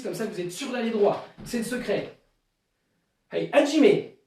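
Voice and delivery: barks the counts loud like a sensei leading the class; shouted dojo commands. Shown as a demonstration. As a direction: give each count a sharp, loud call. Leads dojo drill with shouted counts. (commandes de dojo criées, comptes lancés fort comme un sensei)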